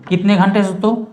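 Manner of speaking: ordinary talk, nothing marked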